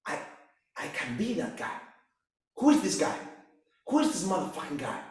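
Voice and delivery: normal talking voice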